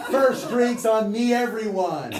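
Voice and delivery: high, loud and slow toast; booming invitation. Retold or imitated. Beloved invites the gunmen to drink, loud and high. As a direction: call out to the whole room.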